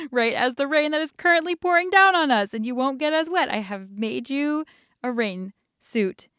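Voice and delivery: lightly voiced dad beaming over his handiwork; dad's voice. Dad to his daughter about her rain suit, lightly voiced proud.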